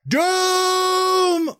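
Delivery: piercingly high, loud, long cry; dog-frightening bellow. Shown as a demonstration. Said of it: "Really frighten the dog".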